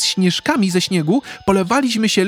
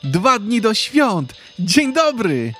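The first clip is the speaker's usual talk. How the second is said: put-on high, slow, happy child greeting; joyful exclaim; 12 semitones higher than usual. A narrator's reading. Boy bursting into the kitchen, joyful high greeting. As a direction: call it out full of joy. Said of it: "wykrzyknął radośnie".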